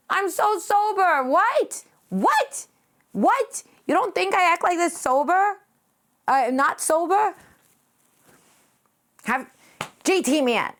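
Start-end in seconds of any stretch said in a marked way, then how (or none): 0.1-5.6 high, rising mock-offended "What?"; mock indignant surprise. Streamer protesting to chat she's sober, mock indignant. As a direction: play it up as if shocked anyone would doubt you.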